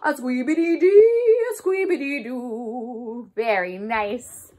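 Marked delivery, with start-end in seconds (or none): 0.0-3.3 saxophone imitation. Shown as a demonstration. Teacher imitates the saxophone for the kids. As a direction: croon it like a jazzy saxophone. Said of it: "Can you try to do that with me?".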